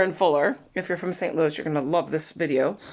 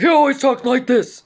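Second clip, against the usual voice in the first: loud, raised imitation of a man with no quiet setting; booming stepfather voice; 6 semitones higher, 8 dB louder than usual. Daughter imitates her stepfather who filled the room, booming. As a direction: belt it out as his everyday voice. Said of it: "he never really spoke quietly".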